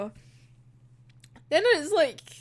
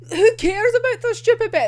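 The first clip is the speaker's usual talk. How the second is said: high, loud dismissive retort; mock indignation; 7 semitones higher, 6 dB louder than usual. Retelling a character who dismisses the bet, indignant. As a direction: scoff at it in a raised voice.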